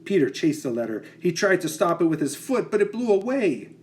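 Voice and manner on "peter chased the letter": ordinary delivery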